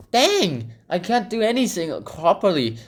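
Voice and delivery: [name] sulking to herself, high and whiny; high whiny complaint. Retold or imitated. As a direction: whine like a sulky kid. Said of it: "I'm like".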